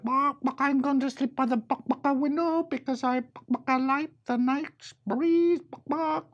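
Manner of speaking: high chicken voice with bark clucks; clucking chicken. Acted. The chicken picks the window in high clucks. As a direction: cluck through the whole line. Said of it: "The chicken said".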